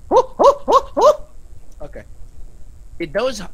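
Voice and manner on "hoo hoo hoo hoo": short, high hoots in a row; high hooting. Real, not acted. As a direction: hoot quickly up high. Spelt hooting noise during play.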